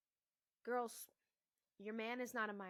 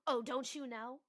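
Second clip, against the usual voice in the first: raised, loud retort in imitation; mock indignant; 3 semitones higher, 7 dB louder than usual. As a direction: mimic a partner snapping back accusingly. Mimics a partner refusing to explain.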